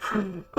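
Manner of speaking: high helpless giggling; laughing. Real, not acted. Streamer cracking up on stream, real laughing fit. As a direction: burst out in a long, high laugh.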